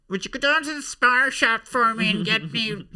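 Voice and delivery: very high, loud falsetto pleading; comic character voice. Acted. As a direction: squeal the errand out in a piercing high voice. Comic character sketch begins.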